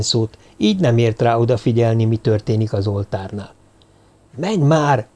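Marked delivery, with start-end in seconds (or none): 4.4-5.1 mildly impatient nudge; impatient urging. A narrator's reading. Druid waiting behind Kígyó, impatiently urging. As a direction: urge them on with a touch of impatience. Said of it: "türelmetlen noszogatását".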